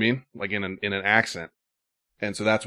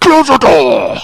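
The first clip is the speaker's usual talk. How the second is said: higher, much louder half-laughing yell; mock Vader yell; 16 dB louder, much slower than usual. Hosts riffing, then a laughing, much louder yell. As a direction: scream it through laughter like a caught Vader.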